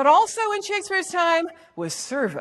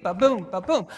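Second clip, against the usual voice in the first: low "ba-boom", BOOM stressed; "ba-boom" like hooves, second syllable heavy; cantering rhythm; 6 semitones lower than usual. Shown as a demonstration. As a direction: trot out "ba-boom" evenly, weak-STRONG. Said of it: "like a horse cantering along".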